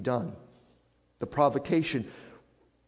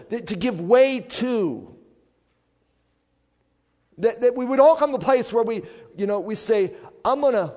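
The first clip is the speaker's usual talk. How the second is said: loud, raised preacher's voice; impassioned preaching; 8 semitones higher, 8 dB louder than usual. Real, not acted. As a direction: press it on the congregation, high and forceful. Preaches at full force.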